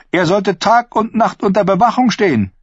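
high, loud and sharp; queen rebuking her knight, loud and high; furious queen. Acted. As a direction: spit it out in cold fury. Queen furious at escaped prisoner.

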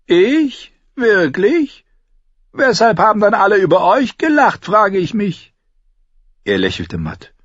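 high, sardonic Tyrion; mocking dwarf voice. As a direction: taunt the knight with a sly grin. Tyrion mocks again.

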